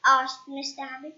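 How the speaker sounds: ordinary delivery, nothing marked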